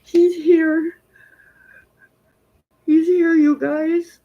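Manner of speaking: choked-up and high, fighting tears; overcome, voice breaking as she cries; real tears for her late dad; tearful crying. Real, not acted. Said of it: "the tears are coming down".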